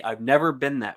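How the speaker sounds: ordinary delivery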